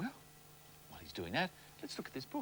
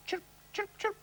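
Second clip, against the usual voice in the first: high chirp chirp chirp; cricket chirp. Shown as a demonstration. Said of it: "just like that".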